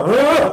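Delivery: short irritated huff of breath; mock exasperated huff; annoyed huff. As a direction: huff like a fed-up parent. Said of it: "Walaupun sama".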